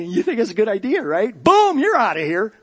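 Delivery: mock-firing the elders with a laughing shout; mock dismissal. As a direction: fire them on the spot with glee. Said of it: "I'd say".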